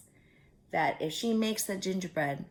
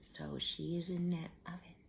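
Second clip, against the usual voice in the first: whisper; 11 dB quieter than usual. Real, not acted. Hushed aside during story.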